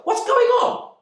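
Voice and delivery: very high, loud yell. Retold or imitated. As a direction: burst in yelling the question. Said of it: "she yelled".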